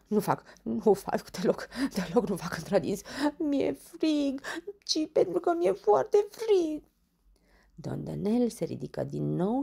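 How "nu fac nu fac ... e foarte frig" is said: stuttering denial rising high through shivers; shivering with cold. Acted. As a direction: chatter it out, freezing. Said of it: "însă și acesta dârdâia". (dârdâind de frig, pe ton înalt)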